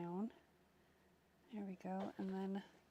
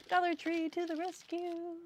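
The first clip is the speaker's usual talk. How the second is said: excited; 9 semitones higher, 5 dB louder than usual. Real, not acted. Cheerful triumphant aside.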